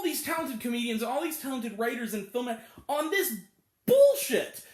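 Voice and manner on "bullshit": much higher, loud angry blurt; angry outburst. Real, not acted. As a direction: explode on the word. Ranting about wasted talent.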